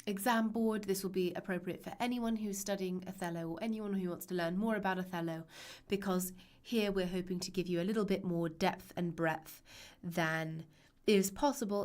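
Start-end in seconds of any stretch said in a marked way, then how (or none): none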